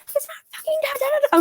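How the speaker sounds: high mock scream of protest; retold screaming. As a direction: reenact a terrified tantrum in a tiny squeal. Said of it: "I'm just screaming".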